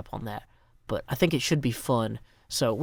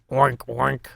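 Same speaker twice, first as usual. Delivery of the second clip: pig oinks. Pretend. Making animal noises for timer.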